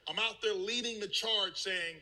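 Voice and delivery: ordinary delivery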